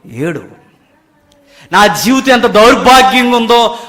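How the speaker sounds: loud, high sermon exhortation; fiery preaching. Acted. Preacher urging the congregation to weep, at full force. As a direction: pound each line at the pews.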